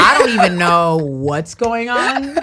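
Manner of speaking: slow, high, loud laughing bewilderment; laughing confusion. Retold or imitated. Mimicking a scroller on Instagram, slow laughing confusion. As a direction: drag it out, laughing, lost. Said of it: "you be like".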